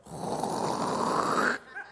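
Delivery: long, drawn-out hawking noise; exaggerated throat-hawk before a spit; imitated spitting noise. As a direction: mimic someone hawking up spit. Imitates spitting sound the disciples hear.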